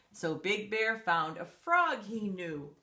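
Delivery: ordinary delivery, nothing marked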